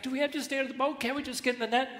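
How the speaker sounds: high-pitched begging, lightly comic; pleading little fish. Acted.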